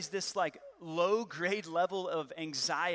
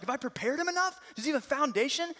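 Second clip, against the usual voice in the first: higher, anxious worried dad; rising panic; 9 semitones higher, 4 dB louder than usual. Retold or imitated. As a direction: spiral into worry about the future. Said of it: "I start just losing it".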